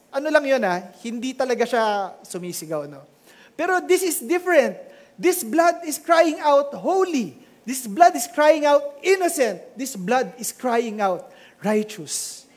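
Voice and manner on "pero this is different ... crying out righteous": rising preacher's proclamation, then slowing; building sermon cry; preaching build. Acted. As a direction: proclaim each word with fervor. Preaching climax, repeated proclamation.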